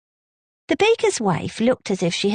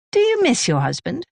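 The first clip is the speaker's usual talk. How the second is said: blunt and direct, pitched higher; blunt question; 6 semitones higher, faster than usual. Acted. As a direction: ask it straight out, no softening. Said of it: "she asked bluntly".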